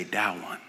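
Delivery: soft, low "That one" said as "dah-wun"; toddler pronunciation. Retold or imitated. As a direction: quietly imitate a toddler's "That one" with d and no t. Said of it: "point at them and say".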